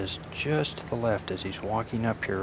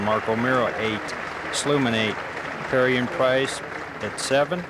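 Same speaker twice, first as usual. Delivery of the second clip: louder live golf play-by-play; golf commentator; 4 dB louder than usual. Real, not acted. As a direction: call the shots like a broadcaster.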